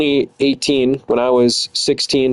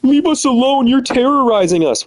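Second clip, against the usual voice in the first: much higher, put-on mocking voice; mocking whiny voice; 12 semitones higher than usual. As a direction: mimic them as pathetic and shrill-high. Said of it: "They gotta be like".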